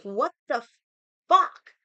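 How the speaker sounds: retold outburst. After the ex-husband insults her, a retold furious yell. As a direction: let it burst out as a furious yell.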